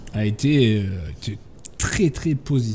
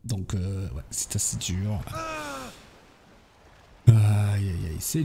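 softer, lower pained sigh; mock pained; 5 semitones lower, 4 dB quieter than usual. Real, not acted. Player reacting to begging prisoners, softer mock-pained tone. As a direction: wince at the prisoners' plea. Hears prisoners beg for life.

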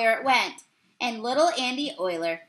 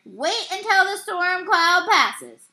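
high, loud, slow call; umpire's yell; 6 semitones higher, 5 dB louder, slower than usual. A narrator's reading. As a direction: project it slowly across a ballfield. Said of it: "yelled the umpire".

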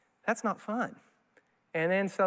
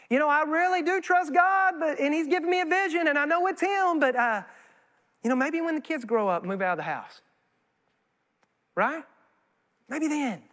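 excuse-making believer; 6 semitones higher than usual. Retold or imitated. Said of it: "imitating believer, high excuse".

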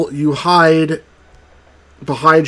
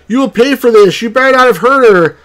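loud, higher threatening king; asserting king; 6 semitones higher, 4 dB louder, faster than usual. A narrator's reading. The king threatens the mother, loud and higher. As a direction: throw the threat at them. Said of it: "asserted the king".

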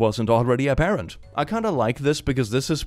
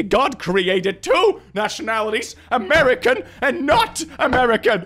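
high, pleading outrage; mock indignation; 9 semitones higher, 3 dB louder than usual. Nationalist recalling two nationalities back in the day, mock outraged. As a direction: proclaim it like a scandalized reactionary.

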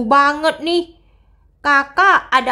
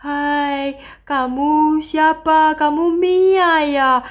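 ghost voice; much slower than usual. Acted. Ghost greets scared Mia.

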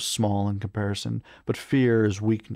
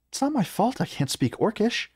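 very high-pitched defensive voice; high, huffy character complaint; protesting companion; 12 semitones higher than usual. A narrator's reading. Clara protests, crossing her arms.

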